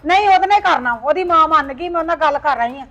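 angry threat. Acted. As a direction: lay down the law loudly. Mother threatens son.

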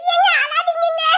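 mock crying. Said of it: "நான் என்னெல்லாம் கற்பனை பண்ணேன்".

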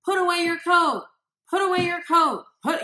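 loud, high, exasperated nagging; high loud nagging at the kids; nagging yell. Shown as a demonstration. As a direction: yell it like a fed-up parent repeating himself. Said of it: "say to your kids".

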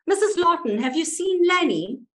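high, loud call through a letterbox; calling loudly. Retold or imitated. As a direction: shout it into the letterbox so she hears inside. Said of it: "said loudly into the letterbox".

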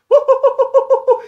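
excited hooting. Real, not acted. Reacting to a big hit in game.

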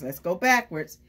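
usual speaking voice

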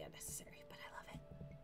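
soft, quick whisper; hushed, breath-only aside; whispered confession. Real, not acted. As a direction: confide it under your breath. Confesses silly wish to self.

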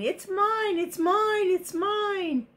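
high, repeated mock yell; mimicked shouting. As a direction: imitate the frogs squabbling. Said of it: "I can hear you shouting".

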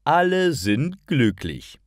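ordinary delivery

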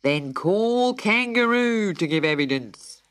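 very slow, pompous bird voice; solemn pelican. A narrator's reading. Pelican calls the next witness, slow and solemn. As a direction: drag out the order. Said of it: "cried the pelican".

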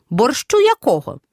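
ordinary talk

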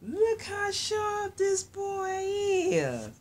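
high, slow, stretched-out admiration; delighted squeal. Real, not acted. Showing how sharp the boy is, high delighted squeal. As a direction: gush slowly in a high squeal.

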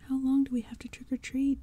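put-on girl confronting the monsters, strained demand. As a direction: push back at them. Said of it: "Tabby demanded".

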